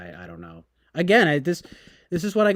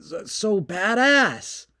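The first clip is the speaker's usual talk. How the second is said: slow, higher gushing on badass; mock awe; 6 semitones higher, 3 dB louder, slower than usual. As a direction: drag out so badass in fake amazement. Mock awe at war news.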